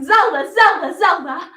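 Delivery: put-on boys hollering her name; yelling. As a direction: holler it out excitedly. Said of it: "they rode by yelling".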